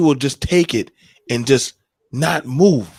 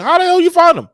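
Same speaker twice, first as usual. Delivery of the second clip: high, loud, incredulous outburst; shocked mom; 13 semitones higher, 6 dB louder, faster than usual. Retold or imitated. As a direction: blurt it out in total disbelief. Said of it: "She was looking at me like".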